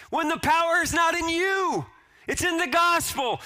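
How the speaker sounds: high, loud preaching shout; preaching at full force. Acted. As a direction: blast the excuses away from the pulpit. Preacher mocks excuses then shouts.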